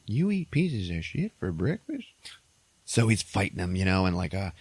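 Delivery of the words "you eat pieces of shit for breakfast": incredulous mock retort. Mocking retort to tough soldier.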